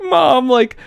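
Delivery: put-on high-pitched little girl's plea; high, pleading kid voice; mock crying child. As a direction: imitate an upset kid calling out.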